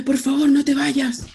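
high, slow pleading cry; gritando asustada. Retold or imitated. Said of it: "mi mamá así como gritándome". (mamá gritándole que no se vaya, súplica alta)

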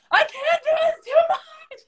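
high, hammed-up wail through laughter; mock cry it out while laughing; laughing whine. Telling her coach she can't go on, laughing and wailing high.

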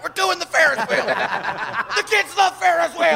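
high, insistent, laughing; stubborn organizer. Retold or imitated. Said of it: "He's like".

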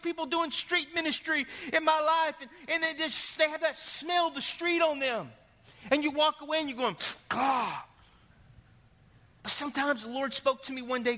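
high, driving preacher's delivery; full-force preaching. Real, not acted. Preacher exhorting the congregation to look at hearts, full-force preaching. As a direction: preach it high and urgent, pressing for a response.

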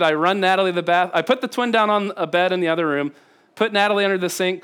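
ordinary delivery

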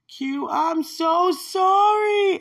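loud, high, drawn-out mock wail; wailed apology. Someone apologizing to the speaker, imitated loud wail. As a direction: stretch it out in a hammed-up, high cry of regret.

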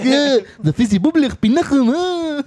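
high mock Israeli accent; exaggerated Israeli accent with throaty sounds; Zohan. Imitating a friend being Zohan, mock Israeli accent. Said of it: "Dude, you're being Zohan".

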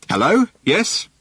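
high, carrying call. A narrator's reading. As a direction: call back across the mud. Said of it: "he called".

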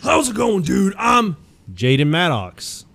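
high shouted wrestler growl, then slow on the name; Hulk Hogan impression. Asked for his Hulk Hogan bit, he shouts a wrestler promo. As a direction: yell it like a hyped-up wrestling promo. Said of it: "Are you doing the Hulk Hogan impression?".

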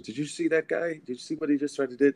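high, fast, indignant questions to the band; angry disbelief. Retold or imitated. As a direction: fire off outraged questions at the band. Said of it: "I was getting very angry".

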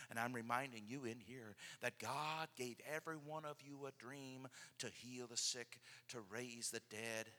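very soft, intimate retelling; hushed storytelling. Acted. Preacher tenderly retelling Jesus and Peter, hushed. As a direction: draw them in, almost whispering.

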